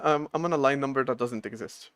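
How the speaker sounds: ordinary talk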